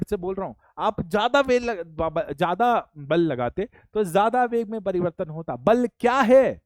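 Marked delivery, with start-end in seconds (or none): 5.6-6.6 loud quiz. Real, not acted. Teacher quizzing class loudly.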